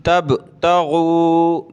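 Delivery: तब with a bouncing echo on the b, slowly; qalqala. Shown as a demonstration. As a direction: give the b of तब a clear echoing bounce. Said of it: "बा पे कलकला होगा".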